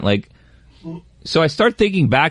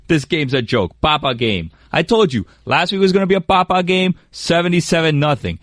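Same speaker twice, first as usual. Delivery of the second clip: normal talking voice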